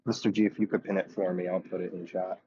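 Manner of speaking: ordinary talk, nothing marked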